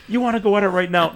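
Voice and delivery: high, quick wrestler's taunt; hammed-up dare to the rival; mock challenge. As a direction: fire it off like picking a fight. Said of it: "And now they go".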